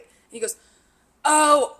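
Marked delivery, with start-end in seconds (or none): 1.2-1.8 high, loud "oh" of recognition; imitated startled realization; sudden realization. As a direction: act out the penny dropping. Kid suddenly realizes his mistake.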